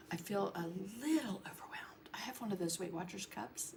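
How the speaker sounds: whispering. Real, not acted. Overwhelmed aside, whispered.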